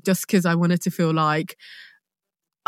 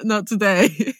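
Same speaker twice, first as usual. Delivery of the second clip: laughing refusal; slower than usual. Retold or imitated. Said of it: "and I was just like".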